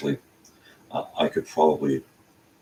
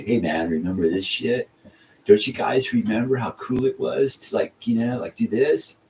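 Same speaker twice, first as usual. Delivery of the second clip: nostalgic buddy voice; 7 semitones higher than usual. Retold or imitated. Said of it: "to come in and say".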